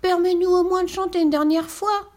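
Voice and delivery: very high, loud begging pigs; pleading piglets. A narrator's reading. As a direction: beg like tiny piglets. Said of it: "implorent les trois petits cochons".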